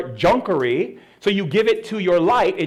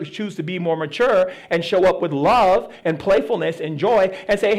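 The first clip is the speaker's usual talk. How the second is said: ordinary talk, nothing marked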